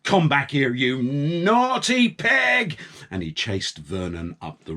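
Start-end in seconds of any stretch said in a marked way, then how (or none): 0.0-2.8 high complaining, rising to a yell; moan, then bellow for the pig; whiny then shouting farmer. A narrator's reading. Farmer Palmer moaning about paint then yelling for Vernon, high.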